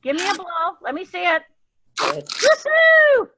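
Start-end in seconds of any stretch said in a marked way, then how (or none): 2.0-3.3 very high, loud woohoo; excited cheer. Real, not acted. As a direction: whoop with delight. Cheering kids blowing party blowers.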